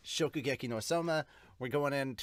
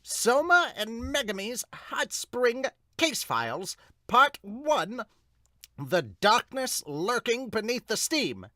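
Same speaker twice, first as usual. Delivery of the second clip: higher, slower title announcement; title card read; 9 semitones higher, 5 dB louder, slower than usual. Acted. Reader opening a manga chapter, grand title read.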